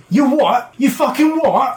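high, indignant halfling challenge; worked-up anger. Acted. Halfling squaring up to a rival contestant, high angry challenge. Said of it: "getting worked up and getting angry".

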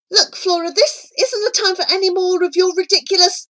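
high, loud, rushed rebuke; worked-up, impatient outburst; exasperated scolding. Acted. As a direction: tell off a friend in a hurry. Charlie scolds Flora, cut off.

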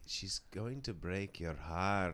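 put-on slow, soft Australian accent; imitating Eugene's Australian lilt slowly; Australian impression. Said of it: "It's not. It's like".

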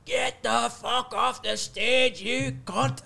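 high, slow mock heckler yell; mock heckler yelling from the crowd; heckler shout. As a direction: bellow it like a drunk heckler from the back. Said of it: "and then you".

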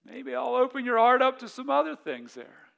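a lightly voiced God with a mild nudge; God replying. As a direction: answer as a patient God, a little amused. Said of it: "He's like".

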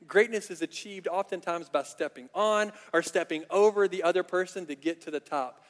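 normal talking voice

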